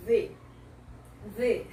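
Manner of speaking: 'V' as 'thee', voiced th; 'V' as a short isolated 'thee'; 'the' with I sound. Shown as a demonstration. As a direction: say 'V' buzzing the th into an ee. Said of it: "faz o som do I".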